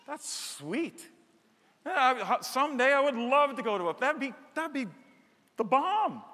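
gushing vacationer. Retold or imitated. Pastor mimicking a congregant dreaming of a getaway, gushing. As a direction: swoon over the perfect vacation spot.

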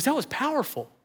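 high, soft imitation of awe; retold emotion. Moved young man to the pastor after prayer, high hushed awe. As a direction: softly squeak it out, overcome. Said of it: "why I'm so emotional right now".

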